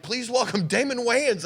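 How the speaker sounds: stage announcer calling the next act. Retold or imitated. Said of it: "they're like".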